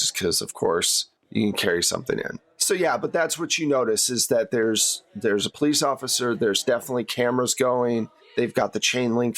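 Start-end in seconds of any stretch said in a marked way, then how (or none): none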